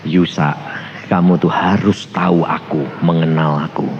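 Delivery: low, soft voice of God; gentle. Retold or imitated. God gently corrects the protesting pastor, low and soft. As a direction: correct him quietly and calmly. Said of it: "Lembut, ngomongnya gini".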